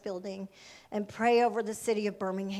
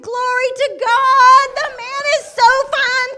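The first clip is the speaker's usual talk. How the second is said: very loud, high, stretched-out exclamation; comic swooning holler; ecstatic praise; 12 semitones higher, 16 dB louder than usual. Retold or imitated. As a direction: shout it like praise in church. Acts out her delight at seeing him.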